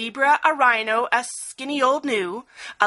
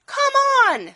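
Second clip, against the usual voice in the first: high, louder rallying call; rally the timid friends; urging elephant; 4 dB louder than usual. A narrator's reading. Elephant urging the animals to the swamp, high and loud. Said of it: "said the elephant".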